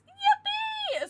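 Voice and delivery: squealed yippee, very high and loud; a burst of pure glee; gleeful cheer. Real, not acted. Celebrating a card pull.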